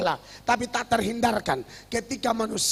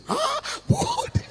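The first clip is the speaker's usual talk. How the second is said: squeaky, much higher, tearful surprise; the mourner realising the wrong name, in a hammed-up cry; mock shocked sob; 3 dB louder, much slower than usual. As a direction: gasp the name in confused grief. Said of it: "Orang namanya Budi".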